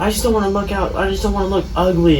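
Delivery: high, loud imitation of a fussing girl; mock protesting. Mocks girl's protest about looks.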